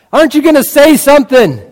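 high, loud pleading question; pleading son. Retold or imitated. Son begging his silent dad to speak, loud and high. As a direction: push the question at a silent father.

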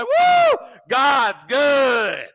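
a huge high Woo, God's good shouted at a stranger; joyful shout. Shown as a demonstration. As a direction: whoop it at the car at the light. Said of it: "get beside them and say".

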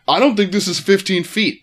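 put-on indignant objection; cranky complaint. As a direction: imitate an irritated driver complaining. Imitating cranky arriving drivers.

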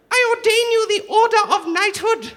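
knight him with mock royal pomp; solemn regal knighting. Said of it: "Can you imagine".

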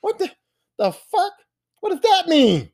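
confused outrage at the lyric; baffled. Retold or imitated. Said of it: "And I'm like".

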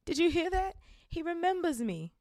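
high, loud thrilled voice; excited. Acted. Diggle telling everyone Harry remembers, high loud glee. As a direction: tell the whole pub with glee.

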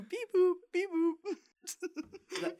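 playful robot beeping; R2-D2 beeps. Pretend. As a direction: chirp it like a little droid. Imitating R2-D2.

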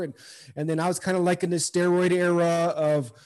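ordinary talk, nothing marked

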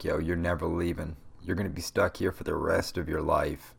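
ordinary delivery

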